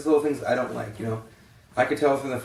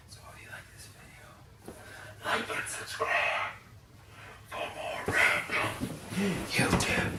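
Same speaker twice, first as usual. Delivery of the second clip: soft breathy whisper, slow; whispered outro; 7 semitones higher, 6 dB quieter, slower than usual. Real, not acted. Vlogger asking viewers to like and subscribe, whispering. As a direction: hush it like a secret sign-off.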